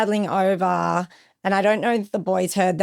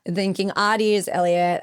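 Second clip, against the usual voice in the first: usual speaking voice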